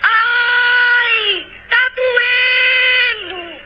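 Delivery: high, drawn-out wail of agony; imitating cries of pain. Woman imitates souls screaming in pain in the pit, long high wails. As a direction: stretch each word into a long, pained mock howl. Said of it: "eles gritavam".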